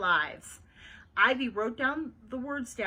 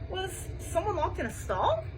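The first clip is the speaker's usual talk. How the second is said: shocked question, pitched up; gasped question; 6 semitones higher than usual. A narrator's reading. Bean guesses at Ivy's secret, gasping. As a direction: ask it in alarm, voice lifted. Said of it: "she gasped".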